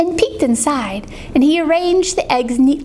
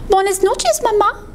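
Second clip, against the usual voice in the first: high little Spanish goodnight; small high voice for mama; baby bird; 8 semitones higher than usual. Acted. Baby bird settling in nest, high baby goodnight.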